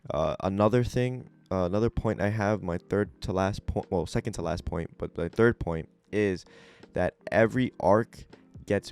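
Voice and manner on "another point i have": usual speaking voice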